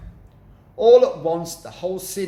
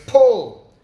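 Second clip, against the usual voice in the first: very loud, higher yell; shouted pull; 9 semitones higher, 11 dB louder than usual. A narrator's reading. Mugglewump yells at his helpers, loud high shout. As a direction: yell the order with full force.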